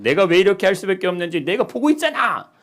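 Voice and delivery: loud, high desperate shout; shouting like someone at the end of their rope. Retold or imitated. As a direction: yell it out in frustration. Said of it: "막 이렇게 막 소리친단 말이에요".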